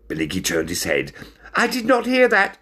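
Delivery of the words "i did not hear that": high, louder fearful shout. Acted. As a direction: yell it, eyes shut. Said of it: "he shouted".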